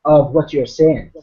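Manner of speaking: brisk, joking Irish accent; Irish lilt with upward inflections at the ends. Shown as a demonstration. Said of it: "kind of an Irish accent".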